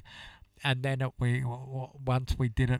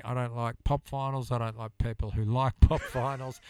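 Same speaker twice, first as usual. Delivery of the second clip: ordinary talk, nothing marked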